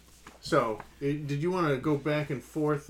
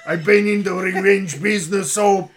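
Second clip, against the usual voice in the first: loud, higher, shouted mock Scarface accent; Scarface impression; 5 semitones higher, 10 dB louder than usual. Co-host re-doing Inigo's revenge line as a shouting Scarface. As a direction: ham up a gangster yelling. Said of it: "I got Scarface over here".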